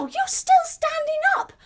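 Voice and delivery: high, loud mock astonishment; amazed. Teacher blew at child trees still standing, amazed. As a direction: gasp it out in wonder.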